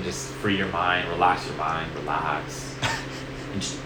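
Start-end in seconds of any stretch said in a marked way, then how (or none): 0.0-2.7 calm meditation voice. Pretend. Demoing the meditation.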